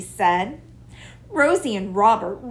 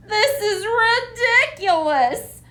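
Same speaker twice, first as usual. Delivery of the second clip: wailing; 12 semitones higher, 4 dB louder, faster than usual. A narrator's reading. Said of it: "she wailed".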